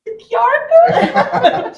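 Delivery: high, loud, slow laughing repeat; laughing mock. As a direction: stretch it out while laughing. Retold laughing repetition.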